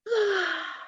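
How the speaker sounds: long weary exhale; heavy sigh. Real, not acted. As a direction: let out a big heavy sigh. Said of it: "Heavy sigh".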